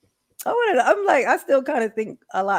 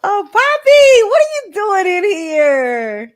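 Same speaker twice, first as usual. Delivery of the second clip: gushing greeting; 9 semitones higher, 8 dB louder, slower than usual. Real, not acted. Greeting a viewer fondly.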